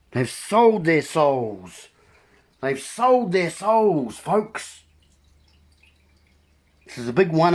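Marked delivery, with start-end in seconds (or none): 0.1-4.8 slow emphatic. Real, not acted. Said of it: "slow repeated emphasis".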